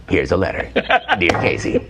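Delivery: radio host reading a letter dramatically; Casey Kasem. Retold or imitated. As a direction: read it out like a long-distance dedication. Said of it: "And he goes".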